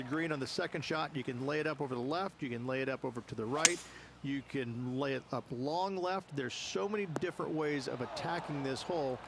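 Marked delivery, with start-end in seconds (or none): none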